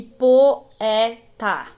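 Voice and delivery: poeta as po... e... ta, slow; split into syllables. Shown as a demonstration. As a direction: pause between each syllable of poeta. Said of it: "elas vão se separar".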